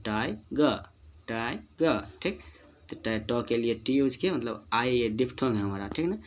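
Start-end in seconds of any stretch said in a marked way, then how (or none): 0.0-2.0 टाइगर as टाइ-गा, no final र; two-beat model of टाइगर without r; short vowel after ग. Shown as a demonstration. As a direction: split टाइगर, ending on a small आ. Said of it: "ग के बाद थोड़ा सा आ बोल देते हैं".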